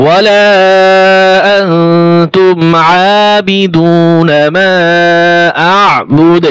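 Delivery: slow, melodious tajweed chant; Quran recitation. Shown as a demonstration. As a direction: recite the verse in long drawn tones. Teacher recites the verse. (ধীর সুরেলা তিলাওয়াত)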